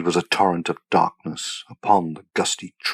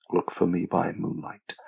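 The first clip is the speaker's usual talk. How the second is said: softer, understated echo of a promise; dead man's voice; 7 dB quieter than usual. A narrator's reading. Bess hears her doomed lover's words, hushed dead man's voice. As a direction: recall it softly, as from the dead. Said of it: "She heard the dead man say".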